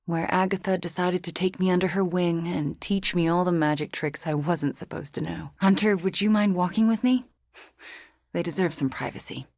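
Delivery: usual speaking voice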